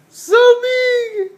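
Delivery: excited. Real, not acted. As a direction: cheer it out with delight. Mother cheering toddler's size.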